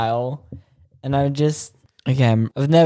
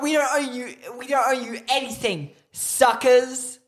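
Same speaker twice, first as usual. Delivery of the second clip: mocking taunt; 9 semitones higher than usual. Mock taunting of listeners.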